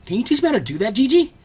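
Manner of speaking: put-on eager kid begging to learn; excited. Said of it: "Naruto asked excitedly".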